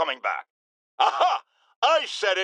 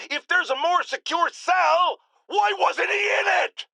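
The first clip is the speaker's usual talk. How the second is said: outraged shout; 12 semitones higher than usual. Acted. Radio host yells at listeners about the Rhino's cell, outraged shout. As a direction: blast the question at the listeners.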